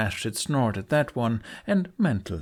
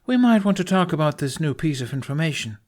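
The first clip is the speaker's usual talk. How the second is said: higher, louder, dry reminder; unamused call; 7 semitones higher, 5 dB louder, faster than usual. Acted. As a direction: pull them back to business.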